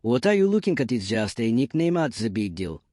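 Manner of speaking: usual speaking voice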